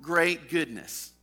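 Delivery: solemn, swelling preacher's reading; scripture reading. Acted. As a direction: read the Bible passage with rising force. Reads scripture aloud at sermon.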